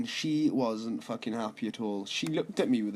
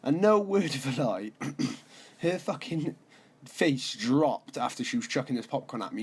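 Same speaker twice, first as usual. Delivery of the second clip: ordinary talk, nothing marked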